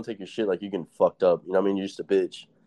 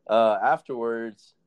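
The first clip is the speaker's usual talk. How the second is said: normal talking voice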